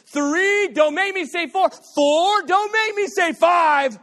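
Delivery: escalating parent shout. Retold or imitated. Parent threatening a child, shouting the count.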